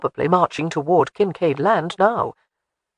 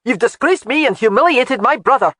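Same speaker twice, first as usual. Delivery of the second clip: put-on raised, louder indignant young noble; angry accusation; 7 semitones higher, 7 dB louder than usual. Philip accusing Jamie of humiliating him, indignant and louder. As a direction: hurl the insult at her, higher and louder.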